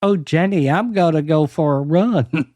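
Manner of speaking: playful put-on Southern accent; Southern belle accent. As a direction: say it as a Southern debutante. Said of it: "as a Southern Belle, can you say".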